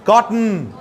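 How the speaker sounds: normal talking voice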